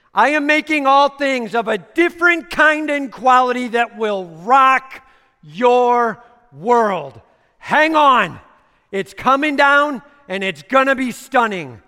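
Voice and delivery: high, loud shouted announcement; proclaiming shout. Acted. Preacher voicing Jesus announcing new things, shouted. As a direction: sustain a loud, thrilled proclamation. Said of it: "Jesus says".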